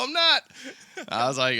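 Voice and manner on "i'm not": high, loud retold denial; mock protest. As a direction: fend off the accusation in playful indignation. Retold protest about hometown.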